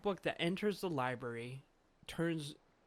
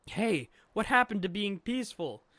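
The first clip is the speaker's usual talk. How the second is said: loud, raised-pitch objection; startled protest; 6 semitones higher, 8 dB louder than usual. Acted. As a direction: call out indignantly at a sudden attack. Luffy protests sudden attack.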